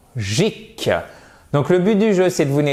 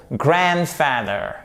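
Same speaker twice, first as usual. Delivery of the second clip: GRAND stressed in "grandfather", slow and falling away; stress on first part; much slower than usual. Shown as a demonstration. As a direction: hit the "grand" of "grandfather", let the rest drop. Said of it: "l'accentuation sur la première partie du mot".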